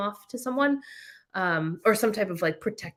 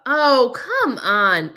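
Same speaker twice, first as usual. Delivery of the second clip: exasperated; 4 semitones higher, 7 dB louder, much slower than usual. Real, not acted. Reacting to a Canadian article.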